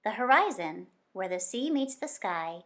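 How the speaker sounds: plain reading voice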